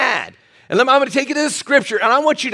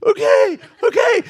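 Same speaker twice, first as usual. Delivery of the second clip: much higher, squeaky giant's reply; mock high okay; 13 semitones higher than usual. Big football player meekly giving in, high-pitched. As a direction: squeak it out like a meek big guy. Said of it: "And he goes".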